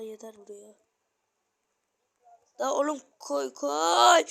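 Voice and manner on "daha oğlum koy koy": high yelling at the game; shouting. Real, not acted. Gamer shouting at the game when a block won't place, yelling. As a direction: yell in frustration, rising. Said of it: "Bağırtıyor beni ya".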